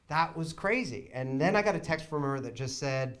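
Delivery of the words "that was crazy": ordinary talk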